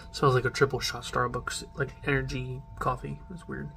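sniffing can while talking; speaking beside a can; can held at mouth. Real, not acted. Seen 0: Can beside mouth, then at nose and mouth sniffing.

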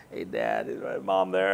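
low, sheepish voice calling home; son on the phone. Retold or imitated. Son phoning his dad from Mexico, low and sheepish. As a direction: ring Dad sheepishly, asking for Mom.

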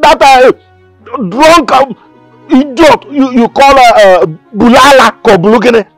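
loud, heated, stumbling rant; angry complaint. Acted. Father complains to Okpala about his daughter's suitor, angry. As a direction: hammer out each warning, groping for the insult.

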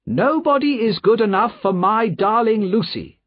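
high-pitched, indignant protective woman; high-voiced cross companion; cross guardian. A narrator's reading.

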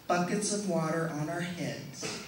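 ordinary talk

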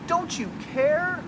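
high, loud, frightened cry; desperate plea. Acted. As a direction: accuse him in panic. Frightened friends plead with Jesus.

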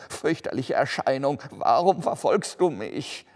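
loud, high-pitched pleading; frightened cry. A narrator's reading. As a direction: cry out in dread at the apparition. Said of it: "rief er". (ängstlicher, lauter Aufschrei)

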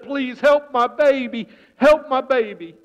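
mother pleading with the chief, desperate; frantic pleading. Acted. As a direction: beg for help.

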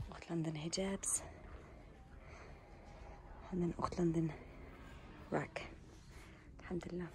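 hushed, near-whispered shop tour. Real, not acted. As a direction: keep it hushed, close to a whisper.

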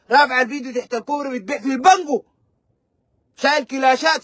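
usual speaking voice

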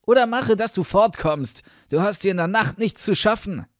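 loud, high-pitched warning; boy's forceful shouted threat; calling out. A narrator's reading. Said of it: "rief der Junge".

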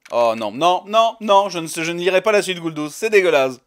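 refus fort, en rafale de non; protestation dégoûtée. Real, not acted. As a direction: proteste haut et fort, écœuré. Refuse une fanfiction, dégoûté. (refus dégoûté, voix forte)

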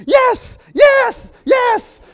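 very high, loud excited squeals of "Yes"; mock overjoyed shouting; really excited. Shown as a demonstration. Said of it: "if you're really excited".

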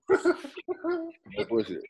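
"No bullshit" drawn out very high and slow; high stretched mock. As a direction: squeak "No bullshit" long and high, half laughing.

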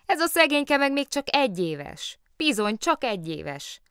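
high, emphatic pleading of the case; triumphant. A narrator's reading. Lotta arguing the bear rides free, triumphant. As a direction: press home your winning argument. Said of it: "Diadalmaskodott Lotta".

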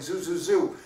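a light, contained holler; shouting. Acted. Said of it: "loudly shouting".

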